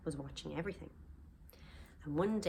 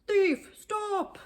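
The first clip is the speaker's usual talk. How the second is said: loud, high shout of alarm; giant's shout; 8 dB louder than usual. Acted. George shouting at Sid as he grabs him. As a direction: yell at the thief to stop.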